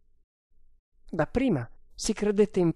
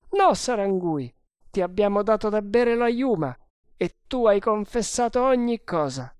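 high, raised character voice; insistent sergeant; 7 semitones higher, 4 dB louder than usual. Acted. Sergeant telling prisoner he was drugged, insistent. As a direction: explain it firmly in a raised pitch.

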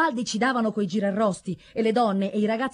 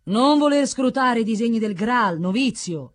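loud, raised elder's warning; sonorous, declaimed rebuke; solemn admonishment; 4 semitones higher, 6 dB louder than usual. A narrator's reading. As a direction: admonish a novice with ringing authority. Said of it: "lo ammonì l'anziano".

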